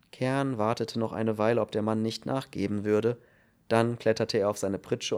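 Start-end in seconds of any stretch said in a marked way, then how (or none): none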